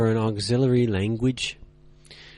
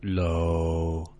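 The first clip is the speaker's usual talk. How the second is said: "low," held deep, lower and flat; low level tone; 7 semitones lower, 4 dB quieter than usual. Shown as a demonstration. Teacher showing learners the third tone, low and flat. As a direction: drop "low," to the bottom and hold it. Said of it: "Don't allow them to go in any direction".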